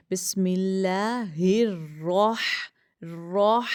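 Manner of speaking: slow recitation, "الرح" rounded to a heavy 'rawh'; thick ra. Shown as a demonstration. Teacher reciting Bismillah to show the rule, thick ra. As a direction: draw out each syllable, "راہ" thick as 'rawh'. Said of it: "راہ پڑھنا ہوتا ہے".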